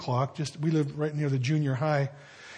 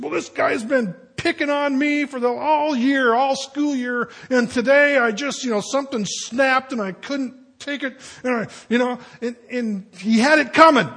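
put-on high-pitched, worked-up kid pleading his case; put-on high voice of a rattled, tearful kid; imitating freaked-out kid; 8 semitones higher, 5 dB louder than usual. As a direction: act out an upset boy justifying himself. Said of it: "he was all freaked out and crying".

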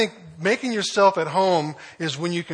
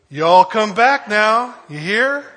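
loud, slow, hearty hillbilly farewell; Jed Clampett; 5 semitones higher, 10 dB louder than usual. Retold or imitated. Preacher quoting Jed Clampett's farewell, loud slow hillbilly call.

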